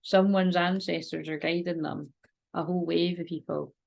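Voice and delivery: ordinary talk, nothing marked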